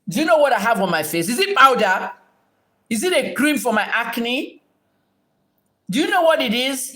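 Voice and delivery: high, heated rhetorical questions; indignant challenge. Real, not acted. Streamer confronts viewer about what is on her face, indignant. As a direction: demand answers, pitch leaping up in outrage.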